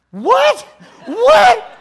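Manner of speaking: loud, high-pitched yelp; alarmed shout. Retold or imitated. Jumper shouting back at his instructor over propellers, alarmed yell.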